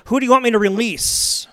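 loud, high call to the crowd; hammed-up Pilate calling to the crowd. Pilate asks the crowd, loud high call.

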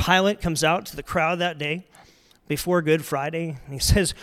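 ordinary talk

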